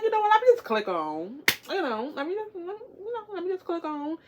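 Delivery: high-pitched little self-talk voice; high, light, repeated hesitant nudge; coaxing oneself. Retold or imitated. Retells talking self into clicking.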